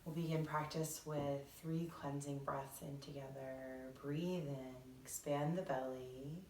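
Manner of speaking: soft, measured meditation voice; guided meditation. Acted. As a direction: ease listeners gently into breathing, unhurried.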